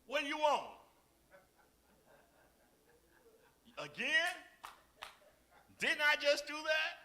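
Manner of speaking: loud, high, exasperated retort; snappish spouse. Acted. Acted spouse refusing a request, exasperated. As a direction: act out a fed-up spouse, voice shooting up. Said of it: "Don't that sound good?".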